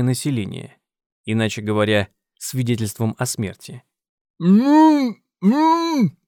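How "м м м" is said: high, strained hum through sealed lips; muffled grumble. A narrator's reading. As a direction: grumble with mouth sewn shut. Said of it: "пробурчал Тед".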